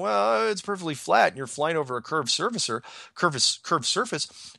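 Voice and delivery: high-pitched, exaggerated comeback; mock retort. Mock reply to imagined objector.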